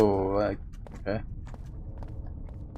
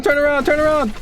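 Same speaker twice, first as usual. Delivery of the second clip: panicking gamer; 12 semitones higher, 3 dB louder than usual. Real, not acted. Monster behind, higher still.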